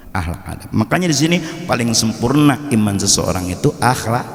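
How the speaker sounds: usual speaking voice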